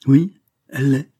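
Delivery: loud, high firm answer; firm reply. Acted. Verduret answers the banker, loud firm reply. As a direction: cut in with certainty.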